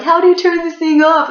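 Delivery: high mocking dumb voice; mocking the cops. Mocking the cops who can't turn the engine off, high and loud. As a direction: squeak it out like a clueless cop. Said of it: "They're like".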